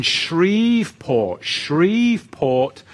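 Shreveport, loud and high, with a long drawn-out "Shreeve"; careful place-name pronunciation. Shown as a demonstration. Host trying out a listener's town name, careful slow pronunciation. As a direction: stretch Shreveport out slowly and loudly. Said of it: "is that what it, how you pronounce it".

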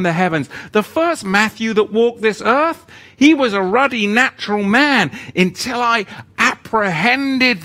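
loud, high revival preaching; fiery preaching. Acted. Preaching at full force.

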